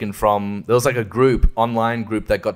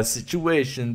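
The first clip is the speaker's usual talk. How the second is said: normal talking voice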